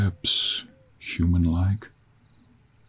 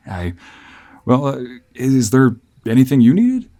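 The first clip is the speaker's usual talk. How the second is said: higher, hesitant traveling fiancé; flustered traveler; 7 semitones higher, 7 dB louder than usual. Acted. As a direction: stammer politely through an explanation to a stranger. Voices the traveler offering help.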